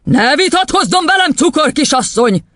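high, forceful angry yell; thundering rebuke. Acted. Headmistress silencing the teacher, angry shout. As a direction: shut the argument down loudly. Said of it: "dörrent rá az igazgatónő".

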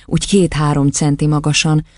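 usual speaking voice

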